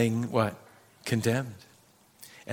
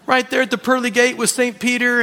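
normal talking voice

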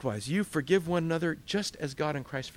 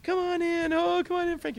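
much higher, beckoning voice; Peter welcoming; 4 dB louder than usual. Acted. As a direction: usher him in warmly and brightly.

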